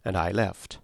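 usual speaking voice

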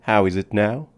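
plain reading voice

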